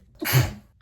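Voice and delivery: sudden sharp sneeze. Real, not acted. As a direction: let out a real sneeze. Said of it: "oh my God, I'm gonna sneeze".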